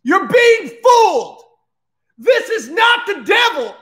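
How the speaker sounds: high, full-force theatrical shout; rally a whole crowd of monsters at the top of your voice; acted outraged yell, very high; impassioned shouted proclamation. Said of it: "And he goes".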